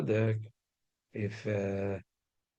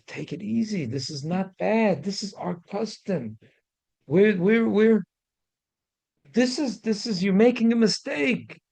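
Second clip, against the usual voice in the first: much higher, pleading, slow then louder; urgent reassurance; 10 semitones higher, 6 dB louder than usual. Retold or imitated. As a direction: rush to calm him, voice climbing high. Mayor calming the crying guest.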